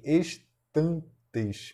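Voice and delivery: "estantes" in three beats, es... tan... tes; syllable by syllable. Shown as a demonstration. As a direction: separate each syllable of "estantes". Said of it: "Por exemplo, ex, primeira sílaba".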